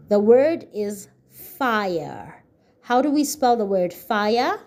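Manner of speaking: normal talking voice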